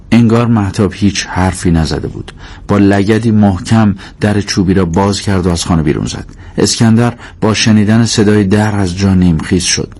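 ordinary delivery

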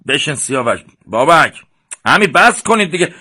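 loud, high pleading calls; shouting to stop. Acted. A boy yells at the brothers to quit fighting, loud. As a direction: break up the fight with your voice. Boys calming the quarrel.

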